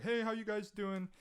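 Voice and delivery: bright high-pitched hello, imitating the couple; mock excited hello from old friends; cheery greeting. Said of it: "They were like".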